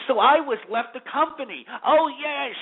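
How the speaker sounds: high, sneering imitation of a spoiled heiress; mock the gold-digger in a high sneer; mocking bimbo voice. Ranting about Hefner's heir, a high mocking bimbo voice. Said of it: "and say".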